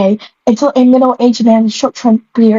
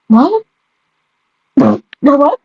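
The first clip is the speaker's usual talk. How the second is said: confused what; 4 semitones higher, much slower than usual. Real, not acted. Confused at the text.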